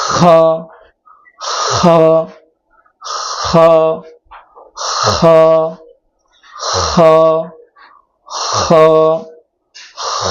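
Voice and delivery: খ from the back of the throat with a light breath; demonstrating kha. Shown as a demonstration. Teacher drilling খ, back-throat demonstration. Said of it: "মৃদু চাপ দিয়ে খটা উচ্চারণ করতে হবে".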